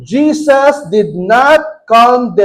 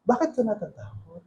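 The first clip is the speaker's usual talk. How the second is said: a soft caring question to the scared; gentle asking; 14 dB quieter than usual. Shown as a demonstration. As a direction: ask it softly and kindly. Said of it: "Iba yung tinatanong".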